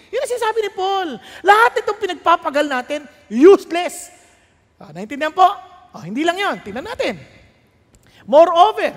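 loud, rising pulpit preaching; fiery sermon climax; preaching at full force. Acted. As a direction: hammer it home to the congregation.

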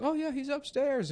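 very high-pitched mom imitation; breezy mom. Friend's mom greeting visitors, high breezy imitation. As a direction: toss it off in a high mock-mom voice. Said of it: "she'd be like".

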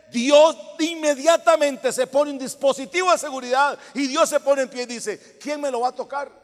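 loud, building sermon cry; vurig preken. Real, not acted. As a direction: proclaim it to the congregation at full force. Preaching at full force.

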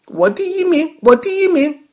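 put-on very high little-boy victory cry; loud, jubilant kid's voice; gleeful shouting. A narrator's reading. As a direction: crow like a child who has won. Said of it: "峰峰笑着、跳着、叫着".

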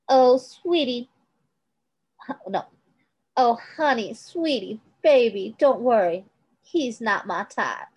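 lightly voiced Kate, taunting with pet names; villainess cooing. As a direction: coo like a cruel woman patronising a boy.

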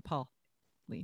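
"Pauline" slowly as PAW-leen, first syllable leading; slow down and lean on the start of "Pauline"; stress on first syllable. Shown as a demonstration. Explaining the emphasis to friends, slow PAW-leen. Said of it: "Emphasis on".

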